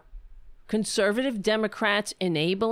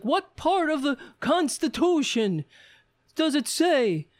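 angry heckler; 7 semitones higher than usual. Retold or imitated. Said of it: "oh, he was like".

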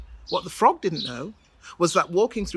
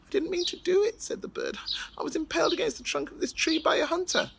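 high, small apologetic voice; pleading bird; 10 semitones higher, 3 dB quieter than usual. Acted. The bird explaining itself to the King of Mali, high pleading. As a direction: beg forgiveness like a tiny bird.

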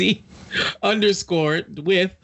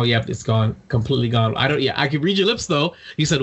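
usual speaking voice

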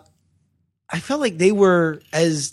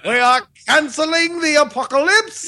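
Idris Elba's British monologue; 8 semitones higher than usual. Retold or imitated. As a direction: declaim it like a rousing British speech. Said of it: "He turned real British".

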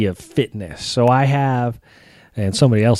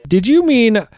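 mock search engine; 6 semitones higher than usual. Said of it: "it kept autocorrecting".

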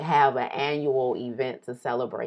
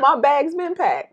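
much higher, louder, slowed proud boast; hyped boast; 11 dB louder than usual. Pretend. Woman boasts her bag is already packed for the trip. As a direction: crow it, bag's ready.

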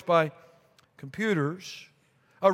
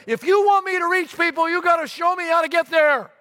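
high, loud retold shout at the sky; yelling at God; 9 semitones higher, 4 dB louder than usual. Lost pastor yells at God from his car, loud and high. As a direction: bellow it out the windshield in frustration. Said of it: "I yelled at God".

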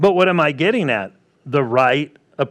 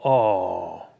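mock sympathy; 9 semitones lower, 4 dB quieter than usual. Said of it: "He didn't stop and go".

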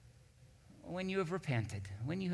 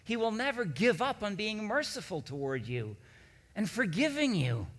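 higher and louder, building to a ringing proclamation; preaching at full force; 8 semitones higher, 8 dB louder than usual. Real, not acted. Pastor to congregation, proclaiming God's faithfulness, building preaching. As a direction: preach it with growing fervor, climbing each line.

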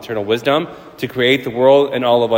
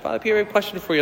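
put-on much higher, soft little kid asking; child voice; 8 semitones higher, 4 dB quieter, much faster than usual. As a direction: pipe up shyly like a small child. Kid asks the priest.

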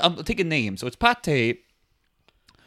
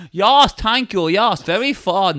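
put-on higher German accent; Hans; 4 semitones higher, slower than usual. German guy thanks his new English mate, polite German accent. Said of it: "Hans, sounded solid".

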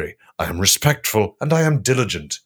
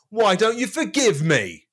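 mock shout; 11 semitones higher, slower than usual. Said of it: "Jesus shouted back".

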